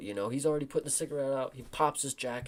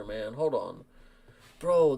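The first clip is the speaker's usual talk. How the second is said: normal talking voice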